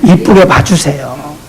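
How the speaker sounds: 이쁘게 봐주세요 soft, low, slow with rising 이쁘게; soft, slow plea to see others kindly; tender plea. Shown as a demonstration. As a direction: gently plead with the flock. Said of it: "gentle plea repeated".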